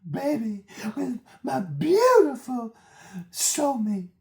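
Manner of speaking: very high squeal, stretching "beautiful" loudly; hammed-up whiny parody of tearful pleading; mock fake crying. Mocking her testimony about home and soulmate, exaggerated fake crying. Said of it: "L P starts fake crying".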